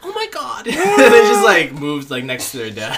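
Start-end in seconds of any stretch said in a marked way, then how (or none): 0.0-0.7 high scandalized squeal; mock offended. Moviegoers scolded by their dad, high mock offence. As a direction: gasp it out in pretend outrage.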